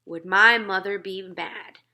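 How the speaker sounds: plain reading voice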